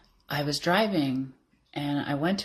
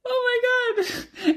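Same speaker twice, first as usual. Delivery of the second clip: very high, drawn-out squeal on the edge of laughing and crying; re-enacted squeal of teary delight; overcome mirror reaction; 6 dB louder, much slower than usual. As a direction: gush at your own reflection, high and loud. Acting own reaction to mirror.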